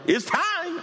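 high ringing shout of It's time; preacher voicing the Lord's joyful shout; imagined shout. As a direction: cry it out like the returning Lord. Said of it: "He might shout".

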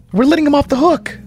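high, fast disbelief; incredulous outburst. Shown as a demonstration. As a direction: blurt it out in disbelief. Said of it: "repeated higher, faster".

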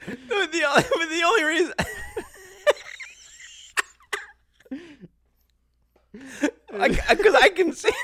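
high-pitched, cracking up mid-sentence; helpless laughter. Real, not acted. Host losing it over co-host's wrong pitcher name, helpless laughter. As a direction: squeal the words out through laughter, breaking off.

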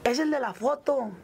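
high, slow mock holler; retold shout. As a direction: imitate someone crying out from behind. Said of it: "en eso grita uno de atrás".

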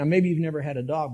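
ordinary talk, nothing marked

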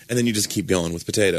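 ordinary delivery

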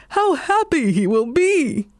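delighted dog calling out; dog's happy cry. A narrator's reading. Dog telling the cat of his joy, happy cry. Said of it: "cried the dog".

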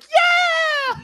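put-on very high shouted child cheer; excited kids. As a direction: cheer like a thrilled little kid. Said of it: "they were like".

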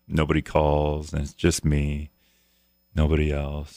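mock self-pity, voice sinking; mock forlorn. Host of [name]'s Alone Show lamenting no callers, mock forlorn.

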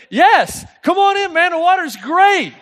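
high, laughing invitation called across the pool; upbeat shouted welcome with a laugh; cheery call. Retold or imitated. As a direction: call them in, all grins and oblivious. Cheerfully calling out to the strangers.

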